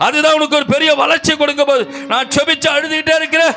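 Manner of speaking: high, driving preacher's cry; impassioned preaching. Real, not acted. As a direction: build from urging to a shouted promise. Preaches at full force.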